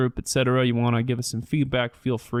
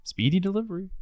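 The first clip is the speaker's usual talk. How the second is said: higher, cheery mailman catchphrase; Mister Rogers mailman; 7 semitones higher, 3 dB quieter, faster than usual. Retold or imitated. Joking about Mister Rogers, quoting the mailman's cheery line. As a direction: call it out like the TV mailman at the door. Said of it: "like Mister Rogers, where the mailman shows up".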